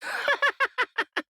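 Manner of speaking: high, wicked cackle; witch's cackle. A narrator's reading. Witch laughing at the girl, high cackle. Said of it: "laughing at her".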